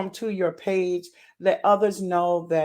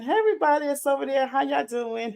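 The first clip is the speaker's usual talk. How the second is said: high, bubbling greeting to the viewers; can barely contain the thrill of the night; real excitement; 6 semitones higher, faster than usual. Real, not acted. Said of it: "let me calm down 'cause I'm so, so excited".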